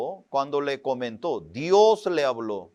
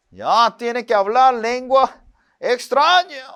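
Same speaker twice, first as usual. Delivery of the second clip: higher, louder order from the pastor; stern pastor; 8 semitones higher, 6 dB louder than usual. Retold or imitated. Preacher imitating his old pastor ordering more fasting, stern. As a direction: bark it like a demanding pastor.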